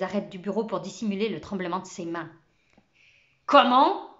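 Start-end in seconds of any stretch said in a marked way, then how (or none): 3.4-4.1 far louder and higher, exploding with rage; gentle teacher suddenly erupts at the boy, furious; furious outburst. Acted. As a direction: blast the word out in fury. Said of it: "sa voix devint basse, grondante".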